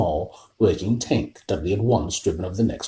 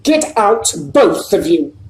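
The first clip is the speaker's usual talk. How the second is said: higher, louder croak; 10 semitones higher, 6 dB louder, slower than usual. A narrator's reading. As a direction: shaken uncle sends them out. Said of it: "croaked Uncle Vernon".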